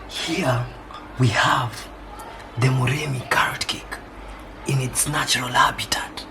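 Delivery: nature documentary. Pretend. Mock wildlife narration of cake.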